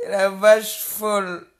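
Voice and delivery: loud, slowed insult with vache stressed, "La vache folle" dragged out; stretched mocking yell of "La vache folle"; slow loud taunt. Real, not acted. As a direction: yell "La vache folle" slowly and lean hard on vache. Repeats the insult, then quietly.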